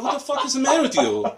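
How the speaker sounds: very high, quick, laughing outburst; retold incredulous yell. Motorcyclist retelling his yell to laughing friends, high incredulous outburst. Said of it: "I yelled at her".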